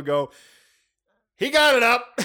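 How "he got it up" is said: high, loud hillbilly holler; Cousin Eddie. Retold or imitated. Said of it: "like Cousin Eddie at the Christmas table".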